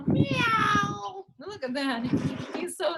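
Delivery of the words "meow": high cute meow; playful little meow; kitten meow. Pretend. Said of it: "cat reveal".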